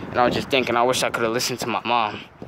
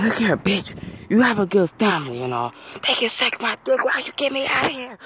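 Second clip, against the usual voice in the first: pitched way up, half-yelling in exasperation; high, exasperated ranting yell; frustrated outburst; 10 semitones higher than usual. Retold or imitated. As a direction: blow up at someone who won't get you out. Snapping at the lady in elevator.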